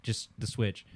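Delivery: usual speaking voice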